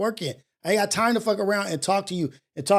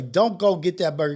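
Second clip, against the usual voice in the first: usual speaking voice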